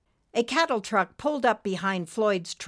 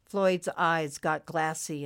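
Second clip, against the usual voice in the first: plain reading voice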